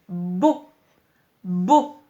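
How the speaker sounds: "bo" loud and isolated, leaning into the b; syllabe détachée. Shown as a demonstration. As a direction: hum into the "b", then punch "bo". Teacher sounds out second syllable. (« bo » fort, le « b » bien appuyé)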